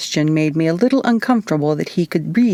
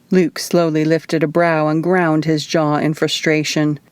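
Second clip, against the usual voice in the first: usual speaking voice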